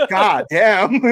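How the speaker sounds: high, loud mock outrage; hammed-up shocked outburst through laughter; retold shocked teacher. Teacher throwing a kid out of class, mock outrage.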